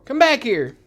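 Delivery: shouting down the hall; calling out. Real, not acted. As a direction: yell after a runaway dog. Calls the fleeing dog.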